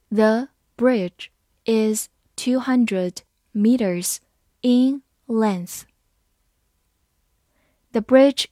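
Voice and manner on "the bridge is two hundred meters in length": slow and deliberate, each word apart; slow reading. Shown as a demonstration. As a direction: read slowly for learners. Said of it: "好，慢慢读". (慢慢地读)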